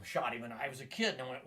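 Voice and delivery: usual speaking voice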